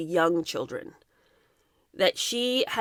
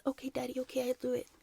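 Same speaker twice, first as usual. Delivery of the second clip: put-on tiny, hushed voice of a child giving in; resigned child; 3 semitones higher, 12 dB quieter than usual. Daughter giving in to her father on set, small hushed child voice. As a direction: murmur it softly, like a cowed little girl.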